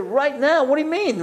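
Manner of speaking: high, incredulous comeback; startled disbelief. Retold or imitated. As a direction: voice jumps up in disbelief. Retold startled reply to urgent request.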